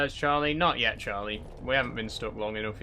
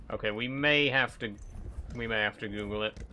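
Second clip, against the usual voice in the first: normal talking voice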